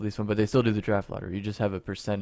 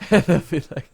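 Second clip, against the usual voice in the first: laughing; 7 semitones higher, 8 dB louder than usual. Real, not acted. Cracking up at the witch joke.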